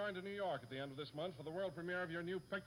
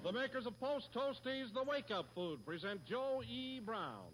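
put-on old radio announcer; 10 semitones higher, 3 dB quieter than usual. Show opening announcement.

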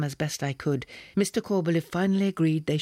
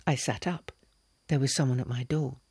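usual speaking voice

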